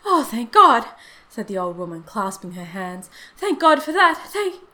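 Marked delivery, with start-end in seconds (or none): 0.1-0.9 loud, high cry of relief; mother's outburst of relief; fervent relief. A narrator's reading. Mother believes her son is safe. 3.4-4.6 high, loud thanks, cut off; relieved outburst breaking off suddenly; fervent relief. A narrator's reading.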